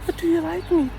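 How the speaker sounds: very high squeaky imitation of her pestering; high mocking girlfriend voice. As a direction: pitch way up as the nagging girlfriend. Mimicking girlfriend again.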